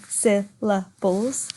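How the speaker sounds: "syllables" drawn out slowly in three parts. Shown as a demonstration. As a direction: break "syllables" into syl, la, bles. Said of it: "has got three syllables in it".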